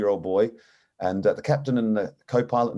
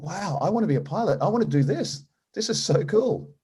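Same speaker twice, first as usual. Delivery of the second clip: put-on amazed boy; 9 semitones higher than usual. Boy amazed after first takeoff.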